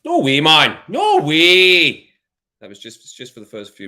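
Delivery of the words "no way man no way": loud, higher burst of disbelief; real surprise. Real, not acted. Streamer shocked that viewers recognise him, loud disbelief.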